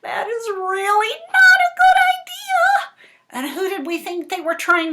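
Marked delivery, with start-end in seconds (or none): 0.0-2.9 very high, loud squeaky warning; high piping chick warning; chicks' warning chorus. Retold or imitated. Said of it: "they kept saying".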